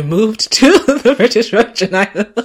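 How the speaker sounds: breaking into loud, high laughter mid-sentence; laughing. Real, not acted. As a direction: crack up as you get the words out. Breaks up laughing, then restarts.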